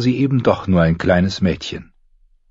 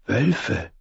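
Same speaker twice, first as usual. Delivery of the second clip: softer, hoarse and scared; hoarse frightened whisper; 3 semitones higher, 6 dB quieter than usual. Acted. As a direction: breathe the word out in fear. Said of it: "flüsterte sie heiser und verängstigt".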